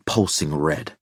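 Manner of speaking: ordinary delivery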